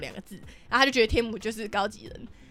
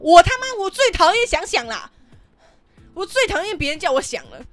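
sudden loud high burst, slowing into a rant; drunken angry outburst; 7 semitones higher, 7 dB louder than usual. Retold or imitated. Drunk at dinner, snapping back at the boss: loud angry outburst. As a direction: blow up at the boss, dragging out the words. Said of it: "然后我就这样跟他讲".

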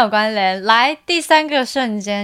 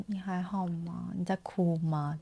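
much softer, gentle check-in; hushed concern; 4 semitones lower, 14 dB quieter than usual. Retold or imitated. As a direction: lean in and ask softly if they're okay. Leader gently asks crying worker. (轻声关心地问)

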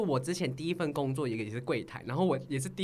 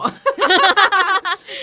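loud high laughing burst; 13 semitones higher, 13 dB louder than usual. Real, not acted. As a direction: let out a big, high laugh. Laughing after her answer. (大声高亢地笑)

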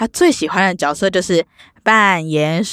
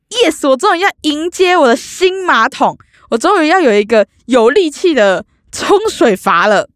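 excited; 8 semitones higher, 4 dB louder than usual. Retold or imitated. 期待新马桶.

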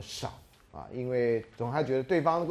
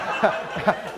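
breathy laugh; 6 semitones higher, 5 dB louder than usual. Real, not acted. Laughs at his own joke.

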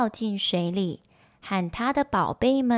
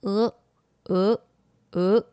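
lightly imitated goose calls; short, separated honks, lightly voiced; goose honking; 3 dB louder than usual. A narrator's reading. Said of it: "一面叫着".

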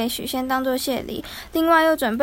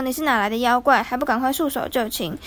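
plain reading voice

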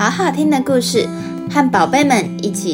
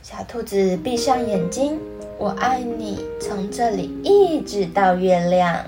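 put-on reading to a toddler; 2 semitones higher than usual. Storytelling narration with character voices.

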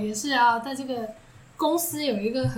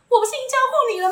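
high, rapid retold yell; mock shout; 10 semitones higher, 3 dB louder, much faster than usual. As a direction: yell it impatiently into the next room, retelling. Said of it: "就对里面大喊说". (模仿朝里面大喊)

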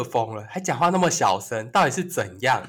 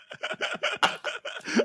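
a very high breathless giggle; laughing at being unbothered, high and airy; 8 dB quieter than usual. Real, not acted. Laughs after story.

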